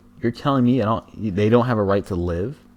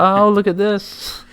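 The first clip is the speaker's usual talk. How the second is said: mock voice; 12 semitones higher, 4 dB louder, slower than usual. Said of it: "It's like".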